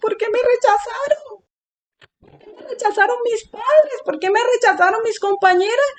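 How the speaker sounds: high, plaintive imitation of weeping; retold crying. Asking God why parents and classmates rejected her, retold crying. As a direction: re-enact a tearful "why me", pitch climbing high. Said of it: "Entonces yo estaba llorando".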